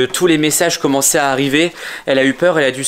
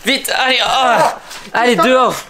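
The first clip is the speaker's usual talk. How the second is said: shouting; 9 semitones higher than usual. Acted. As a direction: drive him out the door. Chasing the monster out.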